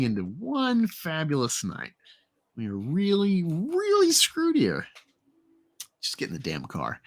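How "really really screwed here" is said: dismayed stretch. Acted. Character despairs.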